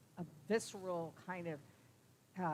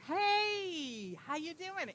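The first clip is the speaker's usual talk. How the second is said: much higher, louder, beaming greeting; happy Uncle Bob; 10 semitones higher, 6 dB louder, much slower than usual. Retold or imitated. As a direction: greet like a cheerful uncle on the phone. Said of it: "He sounded so good, so happy".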